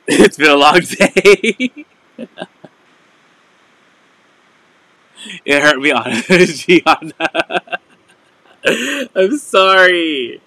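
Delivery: slow words pushed through real giggles; apologising while still laughing; real laughter, can't stop; laughing fit. Real, not acted.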